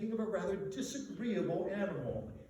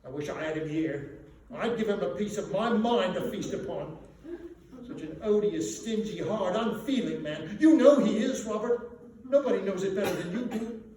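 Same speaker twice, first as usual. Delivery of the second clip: indignant rant; 3 semitones higher, 6 dB louder than usual. A narrator's reading. Wife rails against Scrooge.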